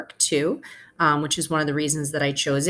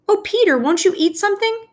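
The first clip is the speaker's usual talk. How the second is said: much higher, louder and fast pleading; anxious mother; 4 dB louder than usual. Acted. Mother begging Peter to eat, high fast worried plea. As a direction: beg the boy to eat, all in a rush.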